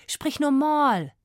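high, loud, drawn-out order from father; upset demand. A narrator's reading. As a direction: demand it slowly and loudly, rattled. Upset father orders his daughter. (aufgebrachte, gedehnte Aufforderung)

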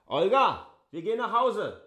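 loud call across the room, pitch up high. Acted. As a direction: call Olga over the noise. Calls Olga to leave. (laut und hoch rufend)

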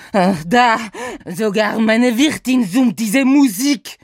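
höher, lauter, mit französischem Akzent klagend; verzweifelter Franzose. Acted. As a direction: gehetzt um Hilfe flehen, französischer Akzent. Saint-Saëns voice. (verzweifelt, französischer Akzent)

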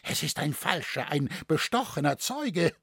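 high, agitated protest; fast, indignant outburst easing slightly; outraged shouting. A narrator's reading. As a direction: demand it, beside yourself. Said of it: "schrie der Kämmerer außer sich".

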